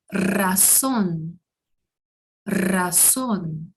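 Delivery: razón with a strong trilled r at the start; rolled r. Shown as a demonstration. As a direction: say razón, rolling the first r. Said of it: "Assim eu vou fazer o som".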